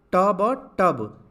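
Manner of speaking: ट, ब, then टब, each sound drawn out and loud; spelled out slowly. Shown as a demonstration. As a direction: build टब sound by sound. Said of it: "तो ये क्या बना?".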